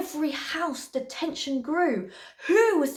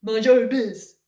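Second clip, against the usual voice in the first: loud, brusque prince; rude rebuff; 2 semitones lower, 6 dB louder than usual. A narrator's reading. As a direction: shut her out curtly. Prince rudely rebuffs Cindy.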